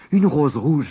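high, loud cry of joy at the rose; he can't believe his luck, cry it out; delighted exclamation. A narrator's reading. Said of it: "s'écria-t-il". (s'écrie de joie, plus aigu et plus fort)

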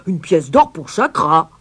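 loud outraged roar; roaring mayor. A narrator's reading. Mayor finally grasps the price, roaring. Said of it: "puis il rugit".

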